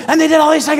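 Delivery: mimicking a nonstop talker, high, loud and rushed; breathless fast talk. Imitating the man who talks so fast, breathless rush. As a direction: race it out without taking a breath. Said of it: "he'll talk so fast".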